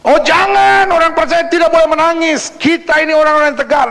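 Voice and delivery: high-pitched protesting churchgoer; mock objection. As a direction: object loudly, then insist in a high voice. Voices objectors to believers crying. (menirukan bantahan dengan nada tinggi)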